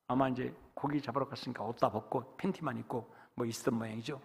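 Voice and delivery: ordinary delivery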